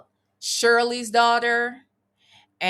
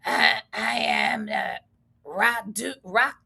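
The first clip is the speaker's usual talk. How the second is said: slow, drawn-out raspy kid voice imitation; a slow mock rasp of the girl; mock raspy little girl; slower than usual. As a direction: ham up her husky little rasp. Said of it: "She done grew up with that little raspy voice".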